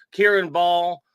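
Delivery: ordinary talk, nothing marked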